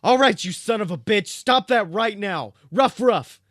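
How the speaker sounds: high, loud dog's shout. A narrator's reading. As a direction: bark it out as a furious dog. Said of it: "Sam shouted".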